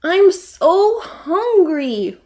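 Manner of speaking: high, slow, loud whine; whining. Acted. Roxy whining to Stumpy about her hunger. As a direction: draw it out like a hungry child. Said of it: "whined Roxy".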